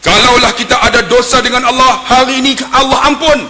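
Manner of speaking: high, loud preaching; forceful, high-pitched proclamation; khutbah delivery. Retold or imitated. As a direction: raise your voice like a Friday preacher. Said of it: "Khutbah Jumaat".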